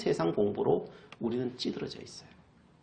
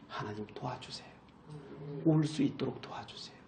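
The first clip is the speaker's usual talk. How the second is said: a lowered, pleading prayer voice with a few fervent rises; model prayer; 2 semitones lower, 4 dB louder than usual. Shown as a demonstration. Preacher showing the congregation how to pray, humble prayer. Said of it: "이렇게 기도하셔야 돼요".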